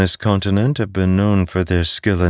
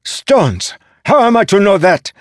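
high, loud exasperated outburst; shouting at the end of patience; 7 dB louder than usual. A narrator's reading. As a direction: burst out in frustrated protest.

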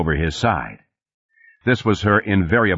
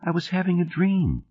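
put-on high, small child's voice; little girl talking softly from bed; little daughter; 12 semitones higher, 4 dB quieter than usual. A narrator's reading. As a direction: pitch it up like a young child. Father voices small daughter at bedtime.

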